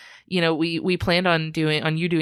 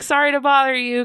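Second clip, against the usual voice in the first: higher, louder mock-sheepish apology; flustered apology; 8 semitones higher, 5 dB louder than usual. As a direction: re-enact an awkward apology to a stranger. Retold apology to the stranger.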